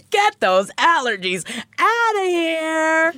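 high, slow mock scolding; high silly voice. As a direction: shoo it away comically. Joking high voice.

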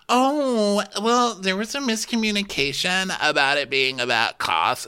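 very high voice easing down; mock defensive squeal. Explaining away the cost dispute, high mock defensive voice. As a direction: start high and put-on, then settle.

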